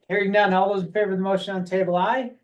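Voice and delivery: rapid-fire auctioneer patter. Real, not acted. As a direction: rattle it off like an auctioneer. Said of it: "You sound like an auctioneer there".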